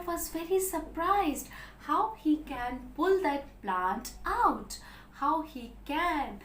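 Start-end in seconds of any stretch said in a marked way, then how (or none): none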